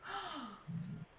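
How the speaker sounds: soft, sudden intake of breath; small gasp of realisation. Real, not acted. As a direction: gasp quietly as an idea lands. Sudden realisation before a question.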